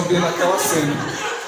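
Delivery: normal talking voice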